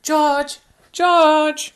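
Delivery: high, loud call across the path. Acted. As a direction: shout the name to find him. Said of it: "calling for George".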